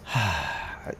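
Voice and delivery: long, soft sigh. Real, not acted. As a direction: let out a quiet, drawn-out breath. Pauses before wrapping up the video.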